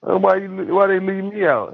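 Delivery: high-pitched mock whine of protest; imitated complaint. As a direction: mimic someone upset at being left behind. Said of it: "you know how people be like".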